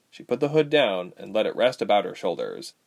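usual speaking voice

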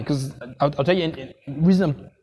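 normal talking voice

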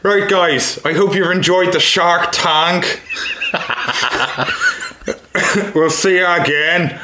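lightly done Northern Irish sign-off; Northern Irish accent. Acted. Guest ending the podcast episode in Northern Irish accent. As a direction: close the show like a Belfast host. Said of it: "That's a good Irish accent".

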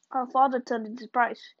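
plain reading voice

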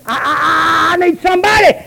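high mock wail, pleading for somebody; desperate plea. Mocking desperate churchgoer.

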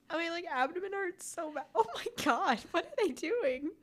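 ordinary delivery

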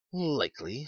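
high-pitched mock dismay; pretended horror. A narrator's reading. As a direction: feign horror, pitched well up. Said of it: "with pretended horror".

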